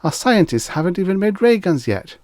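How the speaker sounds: put-on high, loud excited child voice; a kid thrilled by ray guns; eagerly asking in a high boy voice. A narrator's reading. Boy Tommy continues eagerly.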